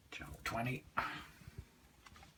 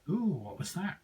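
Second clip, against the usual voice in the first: surprised; 12 semitones higher, 8 dB louder than usual. Real, not acted. Strange sound on the radio.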